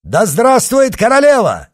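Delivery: high, loud hurrah; ringing cry of acclaim; shouted cheer. A narrator's reading. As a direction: shout it to rally a crowd. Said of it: "крикнул Ганди".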